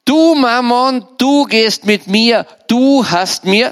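high, slow theatrical declamation; stagey, commanding address; Jedermann confronting Mammon. Acted. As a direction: declaim each "du" as a drawn-out accusation. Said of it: "jeder Mann sagt zum Mammon".

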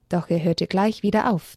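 ordinary delivery, nothing marked

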